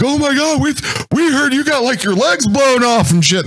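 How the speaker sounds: high, stunned gasp of disbelief; shocked disbelief. Retold or imitated. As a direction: imitate soldiers amazed he's alive. Troops shocked to see him.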